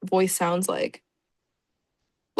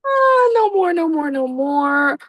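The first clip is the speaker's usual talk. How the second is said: high hammed-up wail, slowing down; mock wailing; 6 semitones higher, 5 dB louder, slower than usual. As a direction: wail it out in fake despair. Fake wailing at picture.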